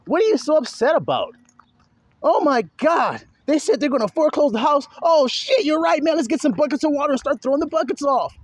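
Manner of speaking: high-pitched, frantic acted freak-out; mock panic. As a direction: act out someone panicking over losing the house. Acts out panic over losing house.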